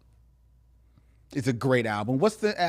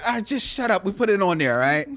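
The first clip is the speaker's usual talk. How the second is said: raised, dismissive mock retort; Spotify; 7 semitones higher, 4 dB louder than usual. Voicing the streaming service answering the fan, mock impatient. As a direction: lightly voice a fed-up company brushing off complaints. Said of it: "Spotify said".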